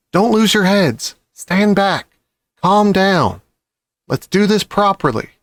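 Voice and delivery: high firm orders to excited cubs; commanding fox. Acted. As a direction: take charge, calm them down.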